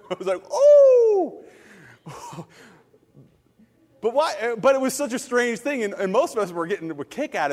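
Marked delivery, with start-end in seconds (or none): 0.5-1.4 very high, loud, drawn-out "oh"; delighted shocked whoop. Retold or imitated. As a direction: whoop at someone's stinging comeback. Reacting to counselor's heavenly comeback.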